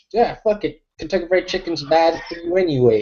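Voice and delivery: a hammed-up careless retort; mock dismissive. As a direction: brush it off like a stubborn official. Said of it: "you're like".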